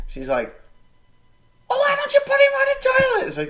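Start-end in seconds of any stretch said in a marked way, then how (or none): 1.7-3.3 very high, loud mock wife voice; high loud imitated wife retort; mimicking wife. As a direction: play the exasperated wife shrilly.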